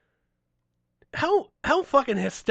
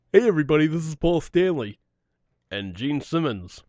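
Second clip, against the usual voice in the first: [name] voice. Retold or imitated. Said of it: "he keeps going in the Gene voice".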